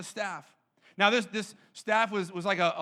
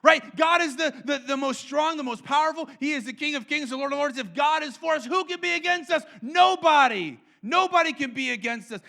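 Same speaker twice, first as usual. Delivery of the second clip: higher, louder, triumphant declaration; bold proclamation; 5 semitones higher, 5 dB louder than usual. Real, not acted. Preacher declaring to the church, loud and triumphant. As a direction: proclaim it with rising conviction. Preacher answering rhetorical question.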